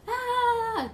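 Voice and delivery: sudden high loud shout "¡Ah!" at someone; imitated angry yell. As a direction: bark a wordless yell in their face. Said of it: "te gritan". (grito imitado de enojo)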